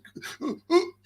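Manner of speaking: high mock gasp of excitement; hammed-up excited intake of breath; mock eager gasp. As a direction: gasp like a desperate troll. Mimicking troll's eager gasp.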